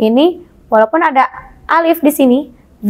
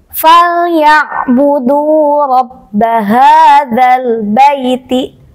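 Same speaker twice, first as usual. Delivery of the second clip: slow, drawn-out chanted recitation, louder; Quran recitation; 4 dB louder, much slower than usual. Shown as a demonstration. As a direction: recite each syllable slowly and clearly. Teacher recites the example verse. (lantunan bacaan Quran yang lambat dan keras)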